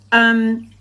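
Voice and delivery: ordinary delivery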